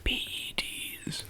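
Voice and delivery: soft, slow secretive whisper; conspiratorial whisper. Acted. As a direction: whisper it like a shady dealer. Slyly suggesting steroids.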